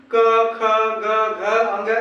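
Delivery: teacher sounding out क ख ग घ अंग from the throat; throat-made consonants. Shown as a demonstration. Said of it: "कंठ से टकरा रहा".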